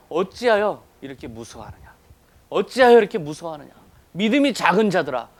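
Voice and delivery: building from measured to loud reproach; preacher voicing a rising scolding; retold rebuke. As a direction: quote a stern rebuke, each phrase stronger. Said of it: "호통으로 이어져요".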